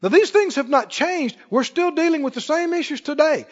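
ordinary delivery

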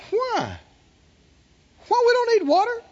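mock the doubters; mock protest. Mocking servants' objection.

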